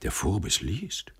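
hushed cry of wonder; a soft, amazed exclamation, rising at the end; quiet astonishment. A narrator's reading. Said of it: "einen leisen Schrei der Verwunderung".

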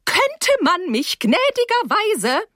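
very high, slow, sarcastic demand; exasperated sarcasm. A narrator's reading. Teacher demanding to be let in, sarcastically exasperated. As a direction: draw out each word, high and indignant.

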